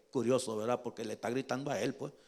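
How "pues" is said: ordinary delivery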